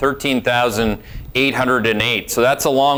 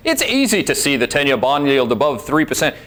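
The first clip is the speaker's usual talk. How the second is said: usual speaking voice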